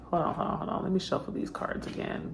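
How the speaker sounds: whispering to herself over the cards; whispered. Real, not acted. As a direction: hold on, hold on, whispered under her breath. Pausing the recording, repeats hold on.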